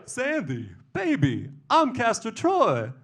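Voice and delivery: croon-call to your sweetheart, then burst upward; John Travolta. Acted. Face-stealer calling to Sandy, high excited impression. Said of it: "John Travolta stole my face".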